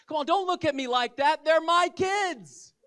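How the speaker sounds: mock defensive shout. Preacher to judging congregation, mock defensive shout. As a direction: climb to a comic indignant yell.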